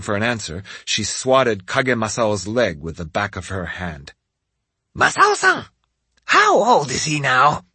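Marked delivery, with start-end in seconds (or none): 4.9-7.6 put-on old woman voice. A narrator's reading. Old lady voice for Hansho.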